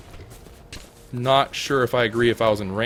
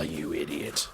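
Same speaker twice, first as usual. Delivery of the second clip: lower, whispered exasperation; whispered idiot at the untamed pet; whispered insult; 7 semitones lower, 6 dB quieter, slower than usual. Real, not acted. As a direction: breathe it in disgust.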